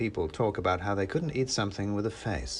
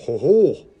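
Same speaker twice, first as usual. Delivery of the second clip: big, loud ho-ho with menace under it; forced Santa laugh; not-quite-jolly Father Christmas; 4 semitones higher, 12 dB louder than usual. A narrator's reading. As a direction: boom it out, short of real jollity. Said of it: "wasn't quite jolly enough".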